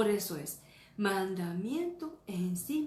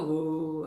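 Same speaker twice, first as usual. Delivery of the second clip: un «¡uh!» fuerte que cae en picada; grito de bajada; 2 semitones lower, 8 dB louder than usual. Retold or imitated. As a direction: como quien baja de golpe en la montaña rusa. Montaña rusa, baja. (grito fuerte de caída)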